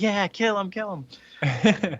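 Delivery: high, egging-on chant; eager cheering. Retold or imitated. Skrulls on the spaceship cheering the fight. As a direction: egg him on to kill.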